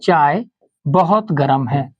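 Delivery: teacher dictating a sentence at slow speed; slow dictation. Shown as a demonstration. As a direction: dictate the sentence slowly to the class. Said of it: "जैसे कि लिखे".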